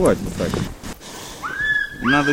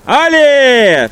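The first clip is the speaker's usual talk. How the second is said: loud falling call to the team; yelling to the dogs; 10 semitones higher, 9 dB louder than usual. Real, not acted. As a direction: shout the command out. Musher urging dogs on.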